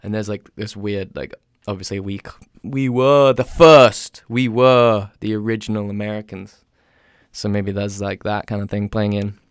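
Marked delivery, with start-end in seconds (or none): 2.6-6.7 loud, drawn-out mock boast; mock superiority. English person claiming to be first, mock superior. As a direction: proclaim it grandly, stretching the opening words.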